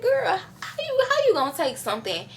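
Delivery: high squeaky disbelief with a laugh; incredulous pleading. Retold or imitated. Teen retelling pleading with her mom, high incredulous squeal. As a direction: squeal it like you can't believe it. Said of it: "pleading with mom, high squeal".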